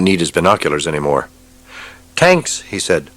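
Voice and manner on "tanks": much higher tense report; tense lieutenant. Acted. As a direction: warn of the tanks.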